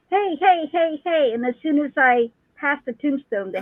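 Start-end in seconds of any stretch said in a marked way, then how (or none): 0.1-1.3 put-on high child's voice calling out. Host retells a ghost child calling out from a tombstone, high child's voice. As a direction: call it like a small child. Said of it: "there was a child going".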